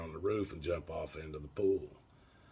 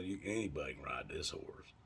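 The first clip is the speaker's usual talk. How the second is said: whisper; 3 semitones lower, 7 dB quieter than usual. Retold or imitated. Friend reassuring about the horse.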